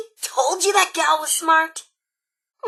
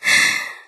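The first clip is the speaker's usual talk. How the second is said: sigh; 4 dB louder than usual. Acted. Weary sigh about the key.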